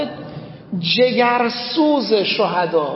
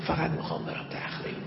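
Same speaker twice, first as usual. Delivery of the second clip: whisper; 5 semitones lower, 12 dB quieter, faster than usual. Retold or imitated. Son insists quietly on demolition unit.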